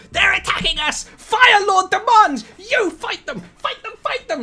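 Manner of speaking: high, shrill goblin screaming orders; panicked goblin yell. Acted. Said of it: "which is like".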